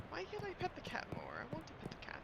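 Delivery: soft unvoiced whisper; whispered whine. Real, not acted. The player whispers a wish to pet the cat. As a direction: breathe the wish under your breath. Complaining about the game cat.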